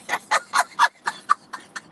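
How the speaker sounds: sudden high squealing laugh; high burst of laughter. Real, not acted. Vlogger cracking herself up over hacking countries, high squeal of laughter. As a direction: break into a high shriek of laughter. Laughs after own joke.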